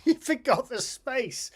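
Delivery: reads the line again through a laugh; amused, laughing at his own mistake. Retold or imitated.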